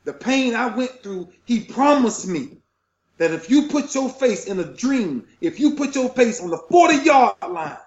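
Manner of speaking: high, fervent testimony; moved, preacher-like conviction; emotional, impassioned. Real, not acted. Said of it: "I'm getting emotional now".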